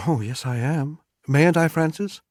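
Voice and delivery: lightly voiced wife, playful; wife insisting. A narrator's reading. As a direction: coax her husband lightly. Wife pleads, voiced high.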